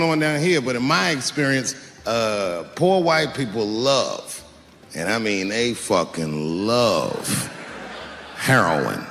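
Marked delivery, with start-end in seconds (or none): 4.9-9.1 low, slow drawn-out build to the punch; slow emphatic punchline. Acted. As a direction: drag it out low before the reveal.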